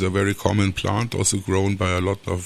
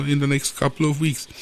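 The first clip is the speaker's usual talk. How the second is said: ordinary talk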